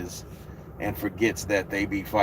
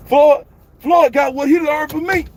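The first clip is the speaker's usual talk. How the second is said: put-on much higher, louder shouting old trainer voice; Floyd Senior impression; 8 dB louder than usual. Doing Floyd Senior for the stream, a high loud boastful yell. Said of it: "Oh, Floyd Senior".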